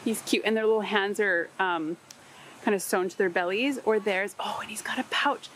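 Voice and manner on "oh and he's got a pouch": whisper. Real, not acted. Discovering the toy's pouch.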